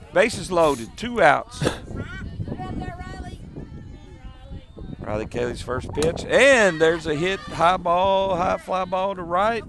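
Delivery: live softball play-by-play. Acted. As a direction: call the game pitch by pitch. Calls the game live.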